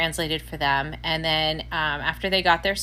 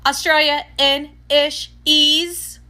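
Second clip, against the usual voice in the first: loud, high, syllable by syllable; slow sounding-out; 9 semitones higher, 7 dB louder, much slower than usual. Retold or imitated. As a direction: spell it out slowly in mock certainty. Acts out the clerk's silly answer.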